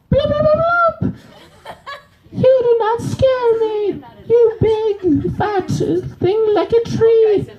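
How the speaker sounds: very high, cartoonish squeaky fish; high squeaky little fish, slow and defiant. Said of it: "versus a fish".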